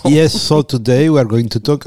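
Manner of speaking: put-on joking switch into accented English; mock-obliging presenter in accented English. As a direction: answer in English with a foreign accent. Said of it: "t'as pris l'anglais".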